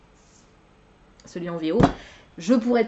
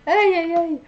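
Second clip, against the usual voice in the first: amused groan; 9 semitones higher, 6 dB louder, slower than usual. Real, not acted. Amused at her blunder.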